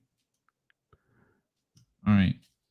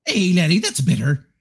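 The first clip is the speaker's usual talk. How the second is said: cartoonish Irish accent, mostly pitched up; comical Irish accent; 6 semitones higher than usual. Streamer retrying the impression more comically, hammed-up Irish accent. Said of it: "something a little more comical".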